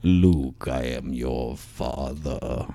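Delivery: deep, slow Vader impression; low and very slow, dragging each word; Darth Vader voice. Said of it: "So I get".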